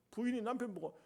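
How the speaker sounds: ordinary talk, nothing marked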